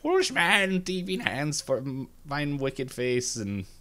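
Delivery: high, theatrical witch pronouncing a curse; wicked witch. Retold or imitated. Hand witch scolding a thief through the watch, high witchy declaiming. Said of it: "the hand witch, like, talks through the watch".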